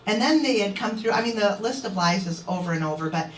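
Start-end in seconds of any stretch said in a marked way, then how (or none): none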